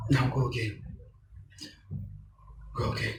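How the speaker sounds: low, soft and steady; a calm coach settling a panicking actor, softly; soothing coach. Acted. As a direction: talk them down gently and quietly. Coach calms the crying actor.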